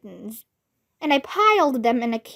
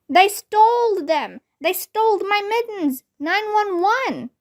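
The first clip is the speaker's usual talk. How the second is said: shouting; 7 semitones higher than usual. A narrator's reading. Girl telling teacher about the theft, upset shouting. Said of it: "I shouted some more".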